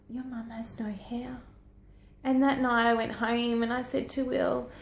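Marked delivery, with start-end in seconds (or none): 0.1-1.4 put-on hushed retelling of a child's remark; whispering the friend's words; whispered. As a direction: breathe it out with no voice. School friend's remark retold.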